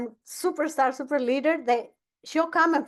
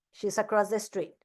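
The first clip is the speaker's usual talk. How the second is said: normal talking voice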